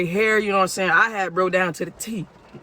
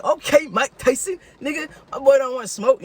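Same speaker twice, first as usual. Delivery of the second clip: mocking; 6 semitones higher than usual. Mocking the beaten thief.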